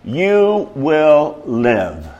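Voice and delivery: very slow, measured delivery; drawn-out scripture promise; solemn climax. Acted. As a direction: let each word stand on its own.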